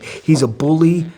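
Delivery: high, loud, slow mock whining; mocking whine. Swim buddy accusing Collins to proctor, mock whining. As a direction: draw it out like a tattletale. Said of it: "you could hear the bitch in his voice".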